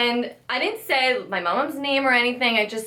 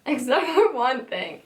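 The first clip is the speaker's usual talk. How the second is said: higher, slower echo with a giggle; laughing echo; 5 semitones higher, slower than usual. Real, not acted. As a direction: repeat it slowly, giggling. Echoes friend's remark, amused.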